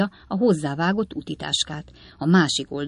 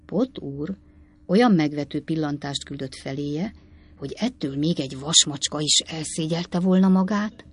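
ordinary delivery

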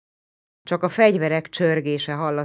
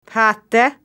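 louder, higher irritated snap back; annoyed retort; 7 semitones higher, 10 dB louder than usual. A narrator's reading. Narrator annoyed at the Roman's suspicion. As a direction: throw it back at him. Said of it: "feleltem bosszúsan".

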